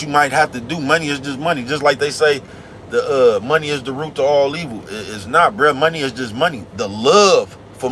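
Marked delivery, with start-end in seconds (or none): none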